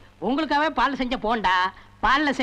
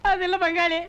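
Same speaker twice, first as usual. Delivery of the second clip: high, tearful wail of hurt; mock crying; 4 semitones higher than usual. Host hurt that his guest disowns him, mock crying. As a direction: whine as if about to cry. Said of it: "அழாத, அழாத".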